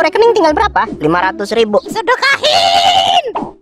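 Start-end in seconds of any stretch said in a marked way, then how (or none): 1.9-3.5 very slow, high drawn-out command; slow, high sermon-style urging; preachy cow. Acted. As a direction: stretch it out like a preacher.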